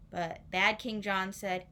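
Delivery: plain reading voice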